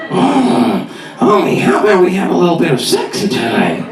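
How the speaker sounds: croaky cartoon wife coming on; Marge Simpson. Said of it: "an adult version of The Simpsons".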